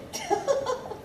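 a high, airy little laugh; delighted laugh. Acted. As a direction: laugh with delight at the trick you pulled. Vampire reveals her trick.

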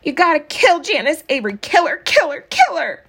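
high, shrill-pitched chant of kill her; a small girl demanding revenge; high childish vengeful cry; furious child. A narrator's reading. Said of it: "stroking Maybelle's head".

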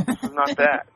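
high, loud laughing through the words. Real, not acted. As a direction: crack up mid-sentence. Laughing while describing huge fan.

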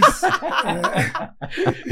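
high-pitched giggle; high laughter. Real, not acted. (risada aguda)

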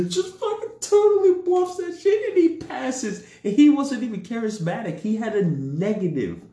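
high, loud, through laughter; laughing while telling. Real, not acted. Player tells friends how the bluff passed, laughing hard. Cracking up over own story.

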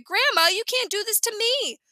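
high-pitched pleading objection; crying out in protest. A narrator's reading. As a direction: echo a child's dramatic protest. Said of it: "And I cried".

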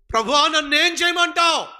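high, loud cry to the Lord; desperate plea. Retold or imitated. A believer cries out to the Lord, desperate and loud. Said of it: "ఈరోజు ఎవరైనా ఈ ప్రశ్నతో".

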